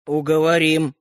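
mildly threatening assurance; menacing. A narrator's reading. Brother vows to persuade new owner, menacing. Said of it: "грозно сказал Родька".

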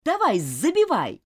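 loud push to score; urging shout. Acted. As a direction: shout it from the sideline. Coach urging players on.

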